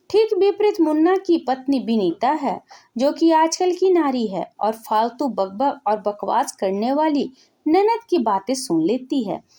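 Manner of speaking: plain reading voice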